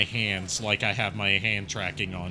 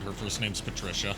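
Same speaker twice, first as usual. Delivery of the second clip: "Patricia" repeated as a softer, hushed afterthought; softer throwaway repeat of "her first name's Patricia"; quiet aside; 3 semitones lower, 8 dB quieter than usual. Shown as a demonstration. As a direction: drop the voice low and tuck it in like a whispered side note. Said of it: "Just as a little side note".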